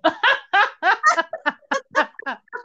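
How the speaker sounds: laughing hard at the story; high burst of laughter. Real, not acted. Se ríe de la historia. (carcajada aguda)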